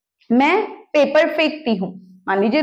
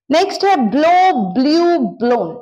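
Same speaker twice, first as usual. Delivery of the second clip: emphatic announcement; 2 semitones higher, much slower than usual. Shown as a demonstration. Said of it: "announcing next verb".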